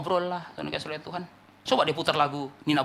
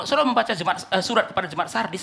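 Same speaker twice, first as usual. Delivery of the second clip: ordinary delivery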